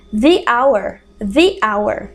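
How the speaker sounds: "the" as thee before hour. Shown as a demonstration. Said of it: "O som ali é de vogal". ("the" como "thee" antes de hour)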